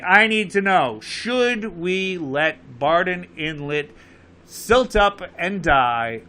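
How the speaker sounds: ordinary talk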